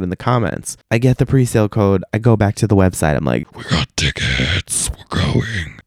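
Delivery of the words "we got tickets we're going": low, slow gloating chant; hammed-up deep victory voice; mock triumph. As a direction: drop low and stretch it out in celebration. Retold triumph on getting code.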